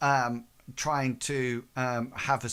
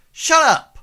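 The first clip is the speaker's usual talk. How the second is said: sudden furious yell, high and very loud; shout; 15 dB louder than usual. Acted. Thug screaming at Leonard to shut up. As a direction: bark him into silence. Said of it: "turns round to, um, you, Leonard, and says".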